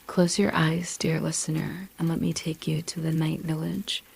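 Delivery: plain reading voice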